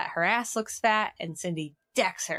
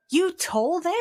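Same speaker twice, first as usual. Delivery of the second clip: high, loud shocked question; upset outburst; 11 semitones higher, 5 dB louder than usual. Retold or imitated. Cindy confronting Buffy, a high loud upset question. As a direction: fling it out, betrayed. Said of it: "Cindy is very upset".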